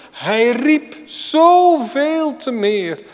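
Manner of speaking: very slow, high and loud, stretching zoveel; drawn-out cry. Acted. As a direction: stretch each word as a long call.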